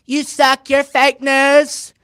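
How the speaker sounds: high, loud mocking imitation; mock heckling. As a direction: drag out the last words like a childish heckler.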